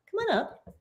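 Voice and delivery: high, soft sing-song call to a cat; coaxing pet voice. Real, not acted. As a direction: coax the cat up to you, high and gentle. Calling her cat up.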